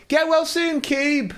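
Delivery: high, sing-song get-well wish; mock farewell chorus. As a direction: chant it like a sarcastic office crowd. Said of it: "And everybody there going".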